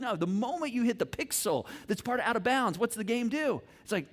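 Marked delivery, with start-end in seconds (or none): none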